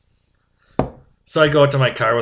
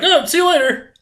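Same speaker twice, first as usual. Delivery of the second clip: much higher, sing-song goodbye; breezy doctor; 14 semitones higher than usual. Retold or imitated. Doctor casually dismissing the patient, high and sing-song. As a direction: brush him off lightly. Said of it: "He's like".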